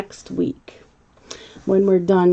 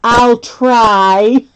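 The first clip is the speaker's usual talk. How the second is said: put-on reluctant toddler. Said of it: "he goes".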